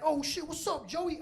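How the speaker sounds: put-on high-pitched, delighted hello to an old friend; acted excited reunion; excited greeting. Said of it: "So he got excited".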